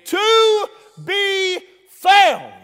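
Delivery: high, loud, drawn-out pulpit cry; full-force preaching. Real, not acted. Preacher calling the church to be saved, full-force preaching. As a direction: stretch each word out in a preacher's shout.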